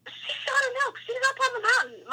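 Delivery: high-pitched, excited retelling of her brother. Retold or imitated. Brother breathlessly telling Mom and Dad, high excited voice. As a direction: blurt it out like an excited kid brother. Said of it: "his English can get really broken up when he's excited".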